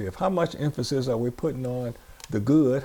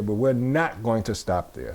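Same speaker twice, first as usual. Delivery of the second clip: normal talking voice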